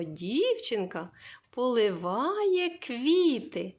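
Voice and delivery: each word drawn out slowly, квіти stressed; slow example sentence. Shown as a demonstration. Teacher reading a model sentence to children, slow and clear. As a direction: spell out the sentence slowly for little ones. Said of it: "ми сказали речення".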